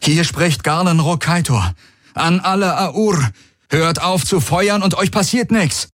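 high, urgent call to the enemy; loudspeaker announcement. A narrator's reading. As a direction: address a battlefield through a helmet speaker. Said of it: "Er aktivierte seinen Helmlautsprecher".

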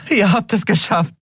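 high joyful repeat; cheering. A narrator's reading. Endriel repeating her joy to the crew. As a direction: say it again, overjoyed. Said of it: "jubelte Endriel".

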